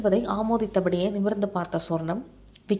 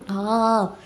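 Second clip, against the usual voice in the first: pain cry; 3 semitones higher, 3 dB louder than usual. A narrator's reading. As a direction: yelp as the blow lands. Slapped on the back of head. (வலியில் "ஆ" என்ற அலறல்)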